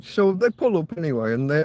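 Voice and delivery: ordinary talk, nothing marked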